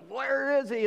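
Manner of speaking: high panicked cry; frantic search. Retold or imitated. Mary and Joseph search the crowds for Jesus, high panicked cry. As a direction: sound like a parent who lost a child.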